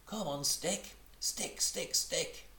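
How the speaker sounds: high cheering Roo, urging the stick; calling out. Acted. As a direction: chant it high like a small child cheering. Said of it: "calling out".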